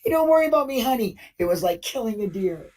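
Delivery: imitating the shooter boasting to his wife, sing-song and careless; mocking the officer. Officer calls his wife after the shooting, high mock-casual imitation. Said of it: "The murderer says to his wife".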